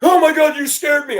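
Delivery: high, loud mock fright; startled kid. Kid startled by the bus driver, high-pitched mock fright. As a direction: imitate a kid jumping out of his skin. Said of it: "kid about shit his pants".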